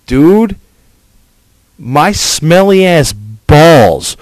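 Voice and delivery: furious ranting shout; angry rant. Real, not acted. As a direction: yell at the posers. Ranting at MySpace posers.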